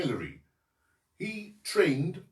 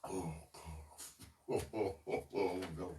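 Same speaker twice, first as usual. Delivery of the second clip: disgusted groans; 15 dB quieter than usual. Real, not acted. Eating the smelly food.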